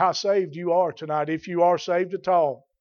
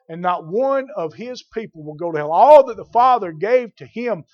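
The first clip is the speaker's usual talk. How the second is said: loud, higher-pitched pulpit delivery; forceful preaching; 4 semitones higher, 7 dB louder than usual. Real, not acted. Preacher declaring Christ's victory to the congregation, forceful preaching.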